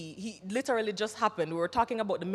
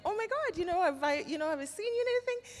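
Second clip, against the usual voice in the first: high, breathless starstruck question; excited recognition; 11 semitones higher than usual. Retold or imitated. Stranger at the table asks about her films, high excited gushing. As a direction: gush like you think you've seen a star. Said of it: "he was like".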